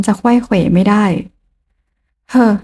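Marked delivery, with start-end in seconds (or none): none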